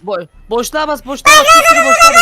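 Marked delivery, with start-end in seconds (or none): 1.1-2.2 high, loud urging yell; urgent high shout. Real, not acted. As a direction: yell instructions in a panic. Urging friend to jump. (yüksek sesle bağırarak)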